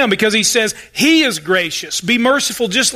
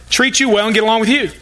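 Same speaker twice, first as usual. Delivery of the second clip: normal talking voice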